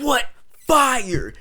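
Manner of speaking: high, loud, incredulous yell; acted-out shocked outburst; panicked shouting. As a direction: holler it in disbelief, word by word. Panicked reply in retold fire scene.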